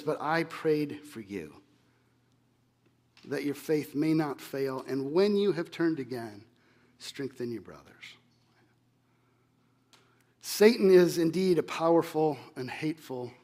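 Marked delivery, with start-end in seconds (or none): 0.0-8.0 tender. Retold or imitated. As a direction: speak it quietly, eye to eye, to reassure him. Looks him in the eye.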